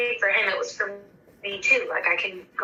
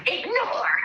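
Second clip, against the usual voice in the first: laughing ignore; 10 semitones higher than usual. Retold or imitated. Slapping hang-up button on husband.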